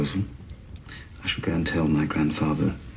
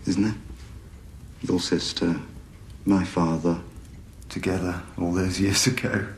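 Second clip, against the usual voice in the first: ordinary delivery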